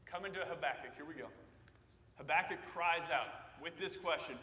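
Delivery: normal talking voice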